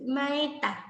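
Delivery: "maeta" with the s and r dropped, said as ma-e-ta; child's mispronunciation. Retold or imitated. Therapist repeating the child's "maeta". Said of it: "te dice".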